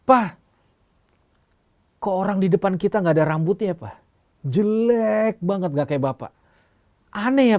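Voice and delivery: put-on high, artless little-kid voice; child talking to father. Boy remarks on bald stranger in lift to his father, high childlike voice. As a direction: pipe up high like a child, stretching key words. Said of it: "tahu-tahu anaknya ngomong sama bapaknya".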